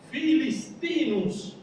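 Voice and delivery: "filistinus" said slowly, stress on -tí-, ending -nus; Latin pronunciation. Shown as a demonstration. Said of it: "filisteo se pronuncia".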